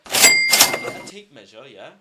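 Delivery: sudden shouted mock demand in a put-on voice; mock outburst. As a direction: bark it out in a big parody voice. Blasting out a mocking demand.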